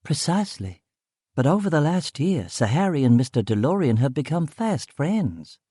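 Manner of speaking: high, lilting female voice; informed woman character. Acted. Woman telling narrator of Sir Harry's friendship, high and lilting. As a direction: pitch it up, swooping through each phrase.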